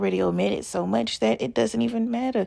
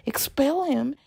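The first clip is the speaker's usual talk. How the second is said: normal talking voice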